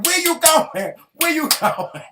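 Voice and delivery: loud, high, insistent repeated question; exasperated calling out. Retold or imitated. Wife reenacting calls to husband leaving hospital bed, exasperated.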